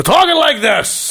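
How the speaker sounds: booming, exaggerated rise in pitch; later Al Pacino. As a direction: blow it up into a hammy holler. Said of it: "his acting became louder".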